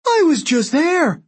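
very high, drawn-out shriek. A narrator's reading. Octavian insists he witnessed the attack, shrieking. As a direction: shriek it in outraged protest. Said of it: "Octavian shrieked".

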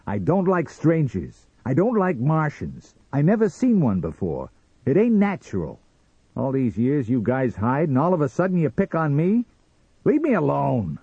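high, loud, aggrieved protest; angry rant. Acted. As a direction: rant at an unwanted stranger. Sam rants at the Martian.

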